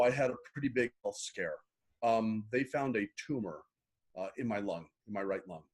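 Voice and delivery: quiet, choked-up telling, voice sinking low and soft; holding back tears as the memory comes; emotional. Real, not acted. Host asks about his turning point, so he tells it quietly, choked up. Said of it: "I'm getting emotional".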